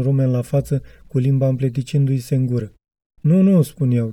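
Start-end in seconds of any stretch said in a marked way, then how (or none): 3.2-3.6 quick denial. A narrator's reading.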